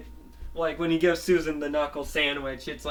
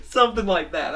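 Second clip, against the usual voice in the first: loud laugh, higher pitch; 6 semitones higher, 8 dB louder than usual. Real, not acted. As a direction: laugh through the words. Laughing at own idea.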